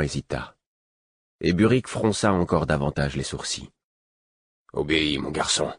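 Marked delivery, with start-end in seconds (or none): 4.7-5.7 firm, contained paternal command; authoritative. A narrator's reading. Father orders his hesitant son to obey, authoritative. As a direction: demand obedience without raising the voice. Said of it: "dit-il d'un ton autoritaire".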